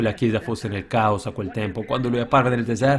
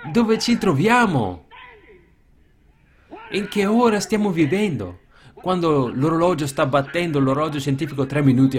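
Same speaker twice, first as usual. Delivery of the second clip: urgent preaching cry; 5 semitones higher, 3 dB louder than usual. Real, not acted. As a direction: call out the alarm to the hearers. Urgent rhetorical questions to congregation. (il predicatore chiede con urgenza alla chiesa, a voce alta e acuta)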